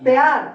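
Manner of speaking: emphatic teacher model পেয়ার; emphatic model word. Shown as a demonstration. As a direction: repeat পেয়ার firmly for the class. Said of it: "কোনটা".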